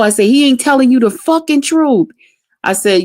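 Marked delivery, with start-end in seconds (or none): 0.3-2.1 higher-pitched retold indignation; indignant protest. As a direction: re-enact protesting to a cousin, pitch raised. Her angry protest to cousin, retold.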